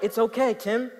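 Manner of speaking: ordinary talk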